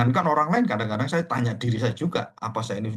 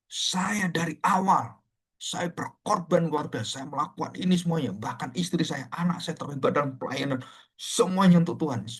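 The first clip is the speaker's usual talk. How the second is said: raised, aggrieved protest, acted out; retold angry complaint; 5 semitones higher than usual. Angry elder complains to pastor about his sacrifices, retold heatedly. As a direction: imitate an elder hotly listing sacrifices, higher pitch. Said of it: "marah-marah. Dan dia mengatakan".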